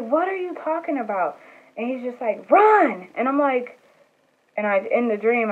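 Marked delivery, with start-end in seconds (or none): 0.0-1.4 confused. Retold or imitated. Confused in the dream chase. 2.5-3.1 high, loud one-word yell to run; shouted warning. Retold or imitated. Friend warning the narrator before the car returns, a loud high yell.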